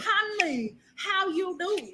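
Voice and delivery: put-on high-pitched comic Vietnamese accent.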